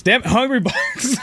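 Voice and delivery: high correction through laughter; laughing. Real, not acted. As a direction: catch your own slip, laughing. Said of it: "Reggie played Smashbox".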